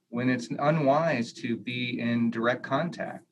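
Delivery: usual speaking voice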